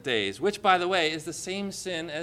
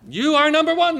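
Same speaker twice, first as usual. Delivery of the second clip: mock flattery; 11 semitones higher, 7 dB louder than usual. Mock speech to wife Beth.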